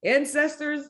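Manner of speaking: high, slow, loud rally; rallying self-call. Retold or imitated. Fighter calling on her ancestors, rising loud rally. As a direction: summon the ancestors, building to loud. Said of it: "I was like".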